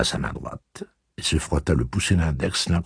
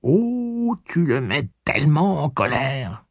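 lamenting; 10 semitones higher, slower than usual. A narrator's reading. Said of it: "se lamenta petit Blaine".

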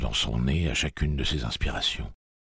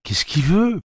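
sifflé; 7 dB louder, slower than usual. A narrator's reading. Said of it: "siffla Susanna".

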